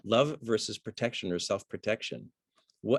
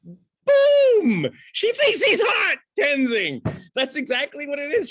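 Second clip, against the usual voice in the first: a much higher, louder triumphant shout; excited outburst; 11 dB louder, slower than usual. Real, not acted. Acting teacher shouts the answer to his class, thrilled. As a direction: shout the reveal like a eureka.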